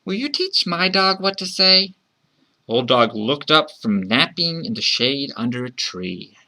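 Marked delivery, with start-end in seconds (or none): 0.1-1.9 put-on high child's voice asking for help; high, singsong child's request; child calling out. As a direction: ask sweetly in a high kid's voice. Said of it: "the child called out".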